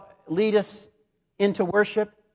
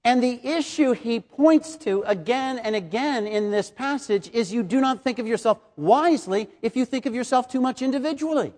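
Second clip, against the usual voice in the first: ordinary talk, nothing marked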